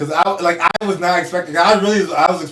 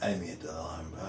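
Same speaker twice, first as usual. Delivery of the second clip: much softer and lower, timid apology; mock-meek reply; 10 semitones lower, 17 dB quieter, much faster than usual. Co-host voicing the driver's apology after the yell, meek and soft. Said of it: "said quietly in reply".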